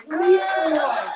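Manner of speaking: loud call to hype the crowd; rousing call. Real, not acted. Host urging the audience to cheer the headliner, loud rallying call. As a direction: belt it out to get them cheering. Repeats request louder to rouse crowd.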